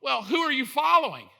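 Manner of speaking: high, loud interrogation; scolding wife. Acted. Wife questioning husband just come home, scolding. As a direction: demand an answer like a fed-up wife. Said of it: "they had to come home".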